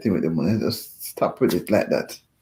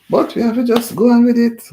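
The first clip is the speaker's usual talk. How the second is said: loud high exclamation; 12 semitones higher, 7 dB louder than usual. Real, not acted. Resigned exclamation.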